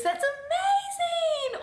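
shrieked very high, slow and stretched; over-the-top excitement. Retold or imitated. Reacting to someone's news, high gushing shriek. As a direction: hear a friend's news and squeal. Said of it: "hearing someone's news".

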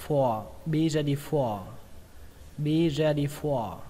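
BE READY FOR, slow, with "for" lengthened; drawn-out FOR. Shown as a demonstration. Teacher modelling the phrase, long drawn-out FOR. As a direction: stretch the vowel in "for". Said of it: "các bạn kéo dài nó ra nhé".

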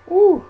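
high loud whoop; excited whoop. Real, not acted. Sudden cheer while playing.

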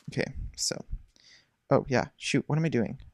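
ordinary delivery

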